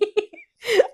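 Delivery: high, loud burst of laughter. Real, not acted. Daughter laughing at her own slang to dad, high laugh. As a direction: crack up laughing.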